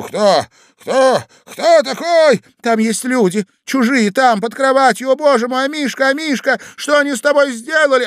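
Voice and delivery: high, loud and climbing; alarmed shouting. A narrator's reading. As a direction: cry out in panic at intruders. Couple discover people under bed. (тревожные крики)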